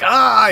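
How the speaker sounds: loud, high cry of pain; pained yell. Acted. Wounded man collapsing, loud acted yell of pain. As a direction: yell out as the leg gives way. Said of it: "He yells out again".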